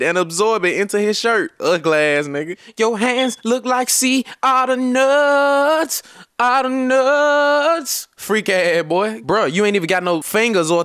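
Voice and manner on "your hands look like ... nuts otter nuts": slow, high mocking drawl; mocking drawn-out insult. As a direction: stretch the insult for laughs.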